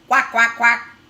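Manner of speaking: loud, blaring duck quacks. Acted. Teacher voicing the grumpy duck, very loud quacking. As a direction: quack as loud as you can. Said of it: "in a very loud voice".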